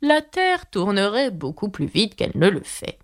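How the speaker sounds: lightly voiced hoarse duchess; hoarse grumble. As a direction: mutter it grumpily. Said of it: "grommela la duchesse d'une voix rauque".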